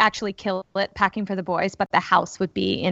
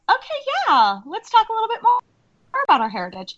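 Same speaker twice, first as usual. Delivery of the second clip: much higher, amused playing along; mock earnest mom; 12 semitones higher, faster than usual. Mom answers her son's Christmassy claim, amused. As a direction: humor the kid with fake seriousness. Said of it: "and I was like".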